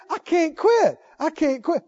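high, insistent mock whine; retold desperate complaint. Preacher imitating the smoker who kept saying it, desperate. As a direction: imitate someone stuck repeating his complaint. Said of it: "he just kept saying".

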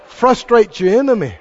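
usual speaking voice

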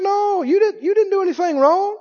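high, gentle reassurance to a frightened woman; reassuring Jesus. Acted. Jesus reassuring the trembling woman, high and gentle. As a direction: soothe her, you did nothing wrong.

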